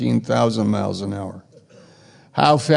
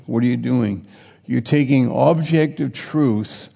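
normal talking voice

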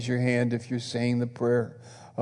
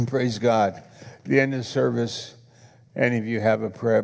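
usual speaking voice

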